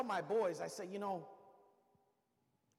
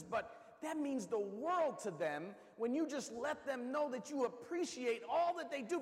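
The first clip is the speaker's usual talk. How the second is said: ordinary talk, nothing marked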